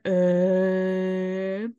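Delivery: long, monotone "uhhh" of blank confusion; dumbfounded "uh". Retold or imitated. Host mimicking two bewildered boys at a science fair, dumbfounded drone. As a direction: imitate stunned kids with a drawn-out droning uh. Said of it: "the look on their faces".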